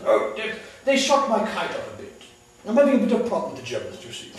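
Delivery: light British understatement; understated British pilot. Acted. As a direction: play the unbothered British flyer. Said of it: "they are the masters of the understatement".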